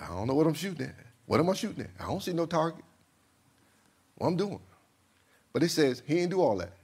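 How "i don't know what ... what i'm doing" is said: soft, muttered puzzlement; puzzled king. Acted. King told to shoot with no target, puzzled and quiet. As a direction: grumble it softly to yourself.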